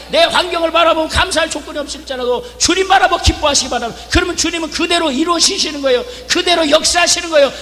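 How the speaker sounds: 절정에 오른 외침 설교; full-force preaching. Acted. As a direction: build to a fiery climax.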